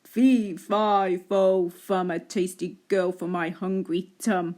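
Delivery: low, booming giant chant. Acted. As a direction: boom it out like a hungry giant. Giant speaks in story.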